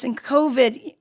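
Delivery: ordinary talk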